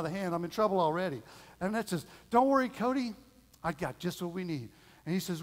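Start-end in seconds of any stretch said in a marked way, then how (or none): 2.3-4.7 louder, higher, bright-voiced comfort for a scared child; cheerful reassuring mom. Mom rescuing her scared little boy, upbeat and reassuring. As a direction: play the rescuing mom full of confidence.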